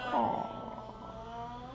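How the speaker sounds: ordinary delivery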